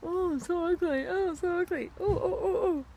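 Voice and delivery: high, whiny mock sob; mocking fake crying. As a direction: parody a self-pitying poster, sob it. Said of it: "where they're like".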